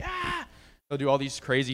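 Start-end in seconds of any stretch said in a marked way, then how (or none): 0.0-0.5 short, very high mock shriek; imitated shriek. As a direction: squeal it like a demon. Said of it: "they'll, like, shriek".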